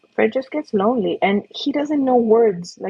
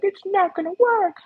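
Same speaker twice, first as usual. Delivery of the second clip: mock whine; 12 semitones higher than usual. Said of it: "and then be like".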